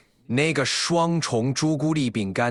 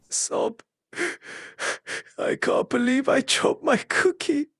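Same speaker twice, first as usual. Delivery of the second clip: fake sobbing; 9 semitones higher than usual. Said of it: "it's like a sad scream".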